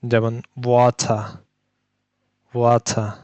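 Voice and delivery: ওয়াথা slowly with rounded lips for the w; teacher sounding out ওয়াথা; w sound demo. Shown as a demonstration. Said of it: "ঠোঁট এবং জিহ্বার পেছনের অংশ".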